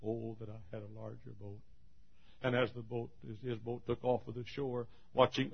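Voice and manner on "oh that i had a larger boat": quiet lament. Acted. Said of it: "looked up and said".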